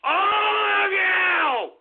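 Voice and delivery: high, slow, drawn-out yell; long angry roar at the chat; furious shout. Real, not acted. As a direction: bellow it at the whole audience.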